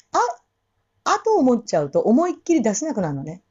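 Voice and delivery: ordinary talk, nothing marked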